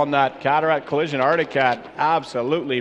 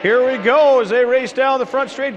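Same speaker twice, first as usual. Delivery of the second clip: high, rising play-by-play; excited race call; 7 semitones higher, 3 dB louder than usual. Real, not acted. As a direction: build up the drag race.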